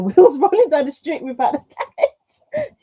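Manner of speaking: laughing while reading aloud; helpless laughter at the image. Real, not acted. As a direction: crack up mid-sentence, loud and high. Reading a funny comment, cracking up.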